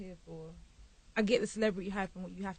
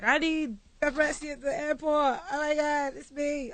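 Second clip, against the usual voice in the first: mock diva; 6 semitones higher than usual. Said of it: "that person that's like".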